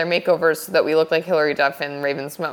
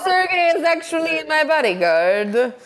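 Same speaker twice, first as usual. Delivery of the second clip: higher, slow, hammed-up Italian accent; mock Italian accent; 9 semitones higher, slower than usual. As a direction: draw it out in a put-on Italian accent. Mock-acts Paolo revealing his bodyguard.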